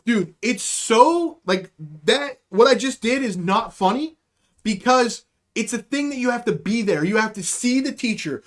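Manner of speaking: ordinary talk, nothing marked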